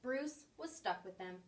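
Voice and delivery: ordinary delivery, nothing marked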